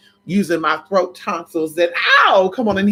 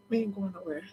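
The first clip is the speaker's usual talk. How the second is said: soft murmur; 3 semitones higher, 14 dB quieter, faster than usual. Real, not acted. Talking softly to woken baby.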